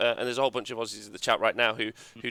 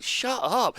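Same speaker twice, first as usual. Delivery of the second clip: mock retort; 11 semitones higher than usual. Retorting to the gushing podcasters.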